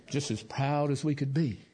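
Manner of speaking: ordinary talk, nothing marked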